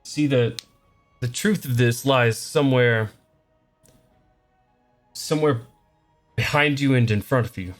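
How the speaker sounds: mystic seer voice; a hint of a cryptic mystic; fortune teller.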